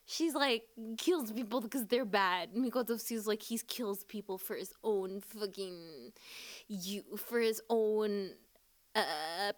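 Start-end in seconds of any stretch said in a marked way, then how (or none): none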